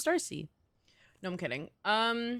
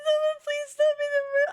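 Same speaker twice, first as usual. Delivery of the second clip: high, fast, laughing plea; high whiny plea; 5 dB louder, faster than usual. Real, not acted. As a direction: beg in a high squeaky whine. Begging chat, laughing.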